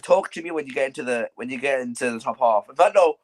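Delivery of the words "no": ordinary talk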